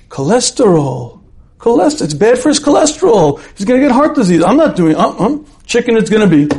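gasp, then high-pitched alarmed outburst; hammed-up panic settling a decision; mock alarm. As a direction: act out a sudden horrified realisation. Wife seizes on an excuse.

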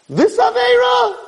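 very high, ringing call; emphatic calling out. Acted. Rabbi listing sins and their cleansers, high emphatic call. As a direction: cry out each item on the list. Preacher calling out each sin.

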